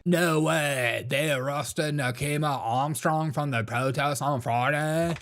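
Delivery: slow, drawling imitation of the accent; hammed-up spaced-out accent; mocking odd accent. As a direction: parody his accent with stretched-out words. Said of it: "what is this freaking accent".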